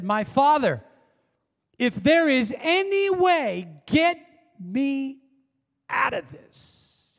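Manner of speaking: high, straining plea to the Father; pleading Jesus. Acted. Preacher voicing Jesus begging his Father for relief. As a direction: beg to be spared, voice climbing.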